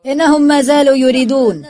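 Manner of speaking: high, projected announcement; loud call to others. A narrator's reading. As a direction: call it out to the crowd. Calls to the workers.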